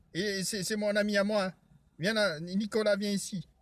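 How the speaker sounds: ordinary delivery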